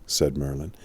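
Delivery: usual speaking voice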